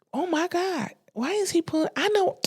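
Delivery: high, soft mimicked exclamation; imitating the therapist. Therapist reacting to her ex pulling up, imitated high and soft. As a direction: pitch it way up, keep it small.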